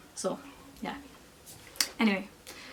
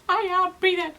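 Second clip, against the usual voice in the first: high, loud, exasperated protest; retold shouting; 10 semitones higher, 6 dB louder than usual. Labouring woman arguing with midwife about breathing, retold shout. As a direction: snap back loudly, pitch way up. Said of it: "I was shouting at them so much".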